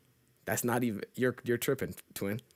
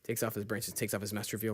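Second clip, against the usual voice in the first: ordinary talk, nothing marked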